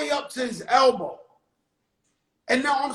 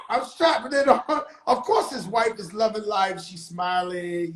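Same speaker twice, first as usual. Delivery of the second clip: usual speaking voice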